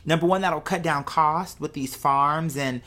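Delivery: normal talking voice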